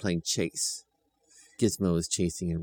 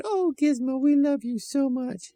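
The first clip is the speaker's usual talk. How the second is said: much higher and slow, fond; sweet children's voice; 4 dB louder than usual. A narrator's reading. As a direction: coo at the puppy like a small child. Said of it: "And they said".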